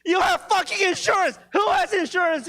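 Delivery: real shocked yelling at the guy in the front row; excited yelling. Real, not acted. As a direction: shout it in disbelief at him. Yelling at audience member's job.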